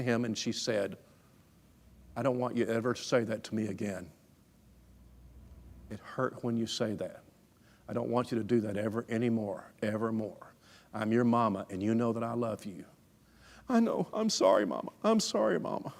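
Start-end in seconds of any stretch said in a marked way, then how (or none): 2.1-12.8 soft, low-key motherly reproof; hushed, unhurried reassurance, imitating a mom; tender, firm mother. Mother gently corrects crying son. 13.7-15.9 put-on small child's voice leaping high, then dropping; imitated child's sheepish apology; contrite little boy.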